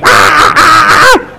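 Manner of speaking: high shrieking cackle imitation; hammed-up high cackling shout; mock laughing shriek. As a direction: screech like a laughing old auntie. Mimicking the old lady laughing.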